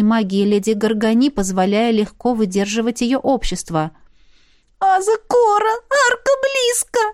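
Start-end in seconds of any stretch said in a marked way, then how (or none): 4.8-7.0 much higher, whiny little creature wail; wailing spirit. A narrator's reading. The spirit Kikimrik wails to Aza that the arch is near. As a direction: wail in anguish like a tiny spirit. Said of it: "с тоской взвыл Кикимрик".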